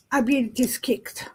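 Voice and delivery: ordinary delivery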